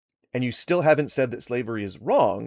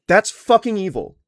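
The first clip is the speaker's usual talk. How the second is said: louder, disgusted condemnation; angry; 5 semitones higher, 7 dB louder than usual. Real, not acted. Streamer condemning a caller's view, angry. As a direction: spit it out in disgust.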